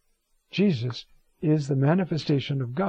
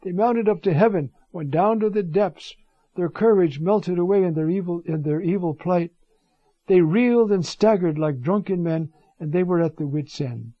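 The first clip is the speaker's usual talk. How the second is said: raised, fervent reading of the psalm; scripture reading; 3 semitones higher, 4 dB louder than usual. Acted. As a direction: proclaim the psalm with rising force.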